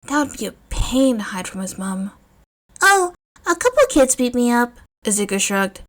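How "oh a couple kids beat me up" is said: put-on young boy voice. Narrator voices young Izuku.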